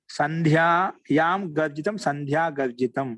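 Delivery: slow, drawn-out సంధ్యాయాం గర్జితం; deliberate teacher's recitation. Shown as a demonstration. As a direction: say each syllable clearly for students. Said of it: "teacher recites Sanskrit example".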